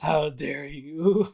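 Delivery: slow, drawn-out accusation; mock outrage. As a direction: stretch it out in betrayed disbelief. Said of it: "people are like".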